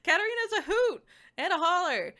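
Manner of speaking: high, loud hammed-up Midwest twang; playful over-the-top Midwest accent; mock Midwest. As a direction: sell it like a folksy Midwest aunt. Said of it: "to make this sound as Midwest as possible".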